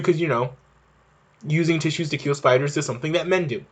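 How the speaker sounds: ordinary talk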